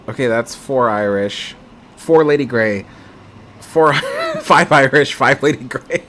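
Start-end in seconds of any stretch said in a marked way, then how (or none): 3.6-6.0 laughing. Real, not acted. Votes keep tying, laughing.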